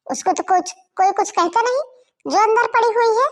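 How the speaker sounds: loud, bitter shouting back at the family; angry outburst. Acted. Daughter-in-law shouting at her mother-in-law, angry and loud. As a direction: throw it back at them, hurt and furious.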